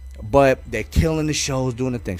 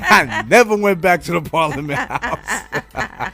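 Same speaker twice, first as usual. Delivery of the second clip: said through laughter, slow; laughing at the memory; 5 semitones higher, 3 dB louder, slower than usual. Real, not acted. Laughing at own story.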